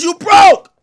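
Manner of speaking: loud and high, jeering; shouted taunt. Real, not acted. Taunting a rapper, a loud jeering shout. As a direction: yell the taunt with a sneer. Heated rant roasting a rapper.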